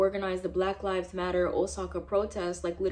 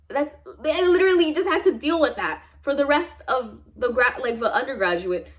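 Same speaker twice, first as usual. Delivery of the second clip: loud, higher, furious rant; angry outburst; 4 semitones higher, 6 dB louder than usual. Real, not acted. Venting to viewers that nobody was punished, loud angry outburst. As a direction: let the anger at the school burst out. Venting about unpunished classmates.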